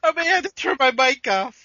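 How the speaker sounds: breaking up with real giggles; helpless laughter. Real, not acted. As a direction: confess while cracking up. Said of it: "I'm laughing here".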